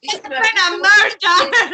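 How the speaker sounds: slow, loud Scottish cry, laughing; a laughing Scottish detective; hammed-up Scottish murder call; Scottish accent. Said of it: "Why am I Scottish now?".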